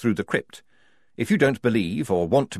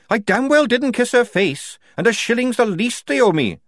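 loud indignant snap, higher; angry retort; 13 semitones higher, 6 dB louder than usual. A narrator's reading. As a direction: throw it back at her. Tonka retorting angrily.

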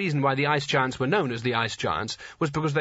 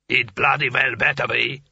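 giant booming voice; 4 dB louder than usual. A narrator's reading. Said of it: "said the giant".

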